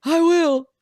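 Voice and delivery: very high, loud squealed reply; high-pitched eager yell; excited shout back. Retold or imitated. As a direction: holler it back in retold excitement. Shouting back to cheering guard.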